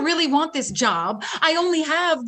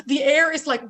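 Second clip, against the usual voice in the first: normal talking voice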